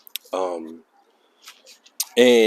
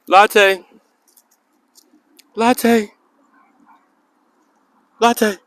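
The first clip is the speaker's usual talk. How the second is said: calling the dog; 13 semitones higher, 8 dB louder, much slower than usual. Real, not acted.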